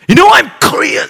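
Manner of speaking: high, indignant shouted protest; imagined angry retort. Retold or imitated. Pastor wanting to correct the abusers, indignant shout. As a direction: shout back, loud and exasperated. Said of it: "하고 싶은 거예요".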